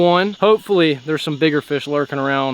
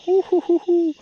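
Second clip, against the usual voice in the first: high, giddy hoots; excited hooting; 14 semitones higher than usual. Real, not acted. Angler celebrating a fish to the camera, giddy high hoots. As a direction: hoot like you can't contain yourself.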